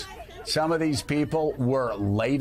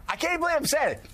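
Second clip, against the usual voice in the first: laughing in disbelief, higher and quick; 10 semitones higher, faster than usual. Real, not acted. Host laughing in disbelief at the quote, laughing. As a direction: laugh it out, can't believe it.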